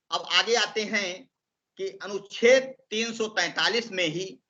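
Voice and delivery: ordinary talk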